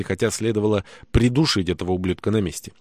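ordinary delivery

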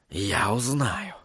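low, soft and slow vow; whispering. A narrator's reading. Sorcerer vowing to find the helper, whispering. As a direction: murmur it slowly to yourself, very soft. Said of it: "прошептал он".

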